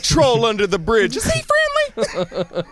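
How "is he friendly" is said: high, slow, laughing country put-on; hammed-up yokel voice. Cohost joins the joke with a high yokel voice. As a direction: squeal it like a goofy tourist. Mock tourist questions.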